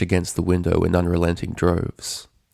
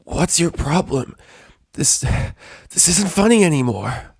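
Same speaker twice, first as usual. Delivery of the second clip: tearful Rainbow; 9 semitones higher than usual. A narrator's reading. Pinned pony pleading with her tormentor through tears. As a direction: beg her to stop, close to crying. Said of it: "Tears had begun forming in her eyes".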